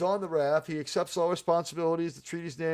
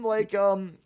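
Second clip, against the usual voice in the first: normal talking voice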